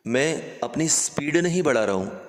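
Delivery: ordinary talk, nothing marked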